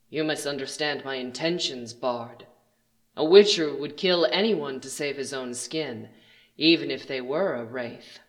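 low, soft, menacing villain voice; menacing stranger. Acted. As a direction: speak low and quiet, coldly condescending. Villain addresses the bard.

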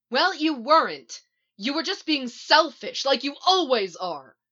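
high, loud, cutting accusation; heated reproach; angry retort. A narrator's reading. Shouted quarrel between Iris and Barry.

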